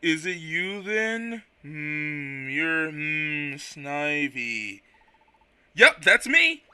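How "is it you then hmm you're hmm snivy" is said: slow, softer, drowsy drawl with hums; settled into the slow Quagsire voice. Acted. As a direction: drag it out low and sleepy. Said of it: "get into my Quagsire voice".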